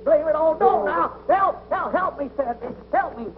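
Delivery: high, frantic cries while being grabbed; panicked pleading. Acted. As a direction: beg for help in a comic panic. Struggles while being tied up.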